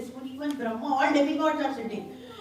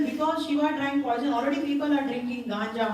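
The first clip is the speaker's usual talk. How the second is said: ordinary talk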